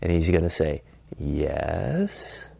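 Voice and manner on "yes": drawn-out soft, wary rising yes; curious. Acted. Prospect answers unknown caller, curious and questioning. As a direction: answer slowly, tilting up into a question. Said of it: "John is gonna be super curious".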